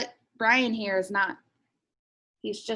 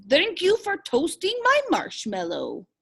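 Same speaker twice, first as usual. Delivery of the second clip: higher, odd little dragon voice; playful creature voice for kids; dragon voice lightly put on; 7 semitones higher than usual. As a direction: voice the cartoon dragon. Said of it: "It says".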